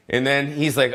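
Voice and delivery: normal talking voice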